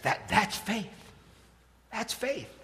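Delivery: high, soft mock awe; hushed revelation. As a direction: reveal it as a profound secret. Acting the speaker's hushed revelation.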